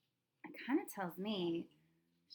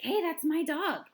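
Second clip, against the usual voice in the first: high, loud, drawn-out yell; 8 semitones higher, 10 dB louder than usual. Retold or imitated. Girl yelled out impulsively to claim her dog, loud. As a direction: blurt it out as a yell. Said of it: "yelled out".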